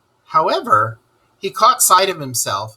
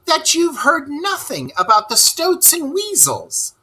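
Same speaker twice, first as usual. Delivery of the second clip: much higher, shouted Rat voice; Rat shouting. Acted. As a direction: burst out at Toad in alarm. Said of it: "shouted the rat".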